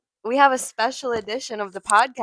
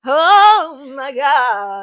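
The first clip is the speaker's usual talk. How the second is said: drawn-out exclamation; 3 semitones higher, 12 dB louder, much slower than usual. Real, not acted.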